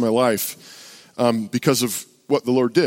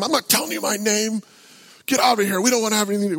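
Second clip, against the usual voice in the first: high-pitched imitated angry refusal; mad heckler; 8 semitones higher, faster than usual. Heckler refusing the preacher's question, mock belligerent. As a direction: play a belligerent man refusing to cooperate. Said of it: "He's mad".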